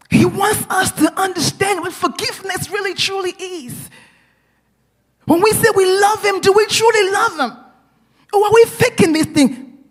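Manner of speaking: high-pitched, urgent sermon voice; fervent preaching, pitch held high; impassioned preaching. Real, not acted. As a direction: challenge the congregation with fervour. Preaches with raised force.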